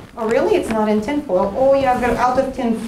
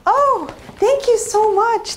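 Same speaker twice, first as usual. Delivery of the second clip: fake gushing thanks; 8 semitones higher, slower than usual. Mock gushing thanks for bad gift.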